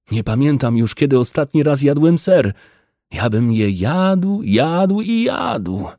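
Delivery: dreamy excitement, pitched high; voice rising. A narrator's reading. Wydra dreams aloud to the farmer of cheese, voice rising. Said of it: "że podnosi głos".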